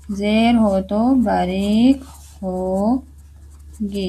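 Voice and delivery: ज़ेर हो तो बारीक होगी drawn out slowly; slow dictation-style repeat, trailing off softly; slow teaching repeat. Shown as a demonstration. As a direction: spell the rule out slowly for the class. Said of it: "अगर ज़ेर हो तो रॉ बारीक होगी".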